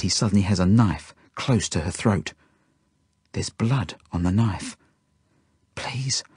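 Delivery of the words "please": soft, higher inward plea; silent begging. A narrator's reading. Felix pleading inwardly about blood on knife, silent begging. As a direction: beg under your breath. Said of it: "I beg silently".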